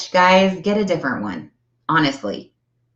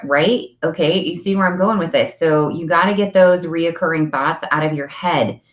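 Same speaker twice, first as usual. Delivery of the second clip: usual speaking voice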